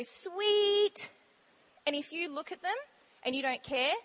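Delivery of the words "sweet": high, long-held sing-out; kids calling out. Retold or imitated. Kids on the bus when you wave back, high happy call. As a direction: imitate a child's approving call, held long. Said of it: "they go".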